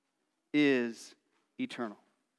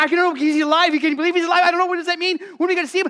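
much higher and louder, tumbling breathless questions; breathless excited rush, much higher; excited, out of breath; 10 semitones higher, 6 dB louder, much faster than usual. Acted. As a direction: babble like you've run all the way. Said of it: "out of breath".

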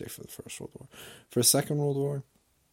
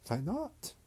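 mock German grandpa; 12 semitones higher, 4 dB quieter than usual. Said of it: "And I was like".

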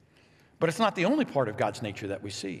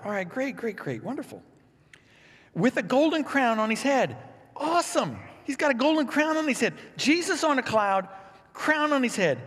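higher and louder, gushing over the golden crown; hammed-up glee at a crowned Jesus; mock delight; 10 semitones higher, 4 dB louder than usual. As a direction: play up the excitement before the twist. Mock delighted reaction to verse.

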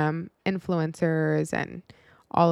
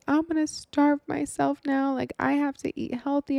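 put-on upset girl; 8 semitones higher than usual. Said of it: "And she was like".

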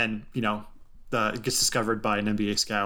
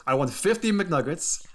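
a hint of Giannis' Greek accent, higher; light Greek accent; 7 semitones higher than usual. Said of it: "In his lovely Greek accent".

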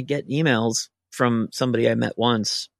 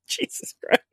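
whispered disbelief; 3 dB quieter than usual. Retold or imitated. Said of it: "And I was like".

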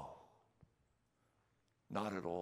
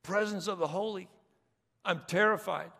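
high, loud cry of fear; mock terror; 12 semitones higher, 11 dB louder than usual. Preacher acts a believer terrified before the holy, high and loud. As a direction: cry out like someone overwhelmed. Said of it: "acting a frightened believer".

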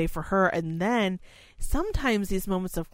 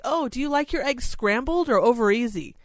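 higher, bright eager question; 6 semitones higher, 4 dB louder than usual. Retold or imitated. Caregiver eagerly asks lucid resident about eggs, higher. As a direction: jump on the chance to ask. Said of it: "You're like".

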